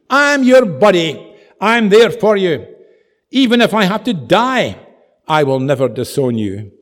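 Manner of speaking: loud, high, impassioned pledge; big, emphatic acted protestation; fervent vow. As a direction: declare loyalty at full volume, as impetuous Peter. Voicing Peter's impetuous vow of loyalty.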